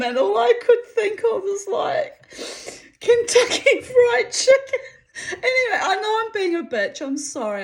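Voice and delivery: very high and loud, nearly crying with laughter; helpless laughter. Real, not acted. Cracking up at her own mean joke, helplessly laughing. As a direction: lose it completely while talking.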